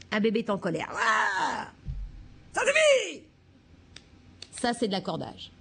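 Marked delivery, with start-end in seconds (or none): 0.9-3.3 shouted angry cry, very high on ça suffit; angry baby. Shown as a demonstration. Teacher acting out a baby's anger. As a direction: explode like a furious baby. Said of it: "Je vais vous le faire pour la colère".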